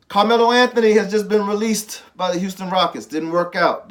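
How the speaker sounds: loud, punchy news announcement; big sports-show announcement; announcer boom. Real, not acted. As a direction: sell it like breaking news.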